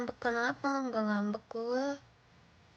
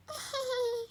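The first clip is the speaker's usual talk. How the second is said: high squealing laugh; 12 semitones higher than usual. Real, not acted.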